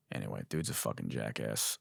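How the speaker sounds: low, quiet dismissive mutter; muttered aside. Real, not acted. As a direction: drop it under your breath.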